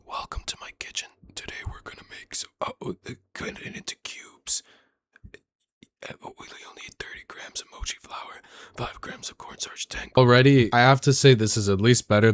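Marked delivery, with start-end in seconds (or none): 0.1-1.1 quick, soft, unvoiced whisper; mock ASMR whisper. Reviewer whispers the chef's recipe over the video, hushed whisper. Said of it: "so I'm gonna whisper over it". 1.3-10.1 quick, soft, unvoiced whisper; mock ASMR whisper. Reviewer whispers the chef's recipe over the video, hushed whisper. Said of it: "so I'm gonna whisper over it".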